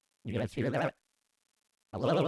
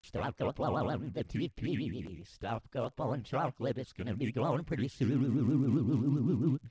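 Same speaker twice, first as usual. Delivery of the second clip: robotic warble; warbling vocoder voice. Acted. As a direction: speak through a warbling effect.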